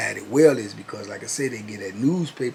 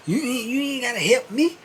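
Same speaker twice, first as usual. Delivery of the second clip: much higher put-on voice, defensive; Keith's reply; 13 semitones higher, 5 dB louder than usual. Keith snapping at his cellmate, high and defensive. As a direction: snap back in a raised voice.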